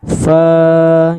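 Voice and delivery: chanted, sustained "Fa"; two-count long vowel. Shown as a demonstration. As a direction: lengthen "Fa" for two beats. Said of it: "panjangnya cuma dua harokat".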